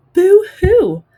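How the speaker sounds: loud, high hammed-up boo hoo; cartoon ghost boo-hoo wail; mock crying. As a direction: wail it like a silly fake sob. Ghost fake-cries in joke.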